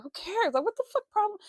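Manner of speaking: high, loud exasperated snap; retold outburst. Girlfriend snapping back at her boyfriend outside, loud and high. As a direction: fire it back at him, pitch up and loud. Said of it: "and I said".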